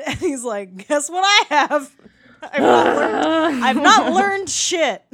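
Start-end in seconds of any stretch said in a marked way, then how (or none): 0.9-3.6 loud slow gloating voice; gleeful defiance. Retold or imitated. Daniel defiant at the end. 3.6-5.0 loud slow gloating voice; gleeful defiance. Retold or imitated. Daniel defiant at the end.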